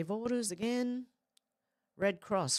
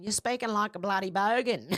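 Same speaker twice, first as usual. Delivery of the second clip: louder, broad bogan Aussie accent; 3 semitones higher, 5 dB louder than usual. Shown as a demonstration. Streamer showing viewers what bogan is, loud bogan accent. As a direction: lay on the bloody bogan accent. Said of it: "Did you see the change in accent slightly?".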